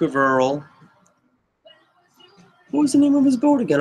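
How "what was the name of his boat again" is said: sudden much higher, fast self-question; high flustered aside. Real, not acted. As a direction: blurt it out high and quick, caught forgetting. Forgets the ship's name mid-story.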